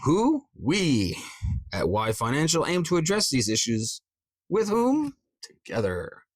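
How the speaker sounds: high-pitched "who" and "with whom", answered firmly; question-and-answer emphasis. Shown as a demonstration. Coach quizzes the script aloud, high questions, emphatic "we" and "together". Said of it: "See how it emphasizes that sentence".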